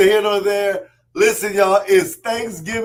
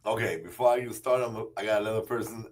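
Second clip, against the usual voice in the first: normal talking voice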